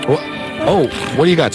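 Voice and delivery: ordinary delivery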